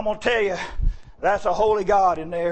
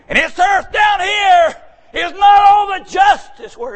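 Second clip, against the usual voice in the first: high, loud fired-up sermon cadence; preaching at full force; 14 semitones higher, 8 dB louder, slower than usual. Acted. Preacher calling to the congregation, loud and fervent.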